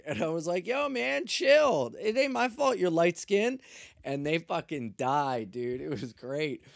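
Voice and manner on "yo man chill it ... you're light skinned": high, loud retort; mock protesting comeback. Arrestee roasting the cop who teased him, mock protesting comeback. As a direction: fire it back loudly, pitched right up.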